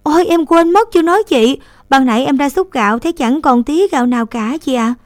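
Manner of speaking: a touch of panic over forgotten rice; flustered. A narrator's reading. Girl telling her sister the rice is gone, flustered. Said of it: "cất giọng hốt hoảng".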